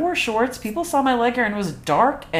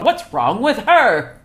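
high, drawn-out gossip's question; hammed-up scandalized ad lady; catty mock dismay; 7 semitones higher, 4 dB louder, slower than usual. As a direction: play a judgmental onlooker, slow and high. Mocking women in old shaving ad.

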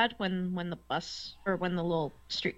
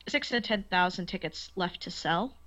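ordinary talk

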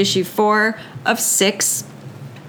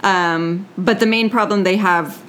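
ordinary talk

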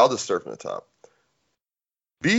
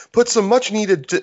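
ad read; 9 semitones higher than usual. Acted. Ad read retake.

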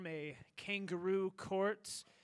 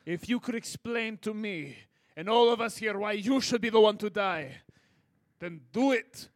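loud, lower stern demand; a hint of a stern interrogator; stern challenge; 3 semitones lower, 5 dB louder than usual. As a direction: press them to justify it. Challenging the volunteer.

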